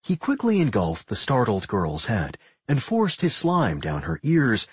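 usual speaking voice

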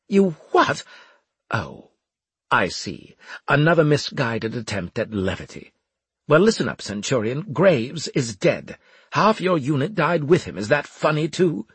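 high startled opening, then stern dressing-down; exasperated officer. A narrator's reading. Officer to joking centurion after Graves' death, exasperated. Voices exasperated Winslade in story.

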